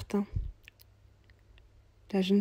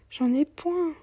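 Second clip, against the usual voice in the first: put-on higher, plaintive young mother's voice; young mother, weary; 8 semitones higher, 3 dB louder, slower than usual. Poor young mother telling the cobbler she's going to hospital, higher and plaintive. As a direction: sound tired and worried about her baby.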